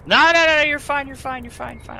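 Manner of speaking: high, loud, flustered no no no; high panicked refusal. Acted. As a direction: wave it off in a squeaky panic. Frantic refusal of drink.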